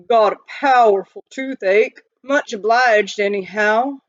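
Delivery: lightly voiced miner with a swollen cheek; a touch of mouthful-of-gravel voice; gravelly miner. A narrator's reading. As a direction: miner mumbling past a toothache. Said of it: "as if he had a mouthful of gravel".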